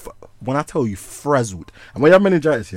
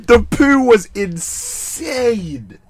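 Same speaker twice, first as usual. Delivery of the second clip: laughing; 10 semitones higher, 4 dB louder, much slower than usual. Real, not acted. Laughing while describing food poisoning.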